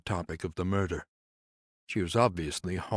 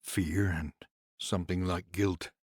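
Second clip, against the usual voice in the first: usual speaking voice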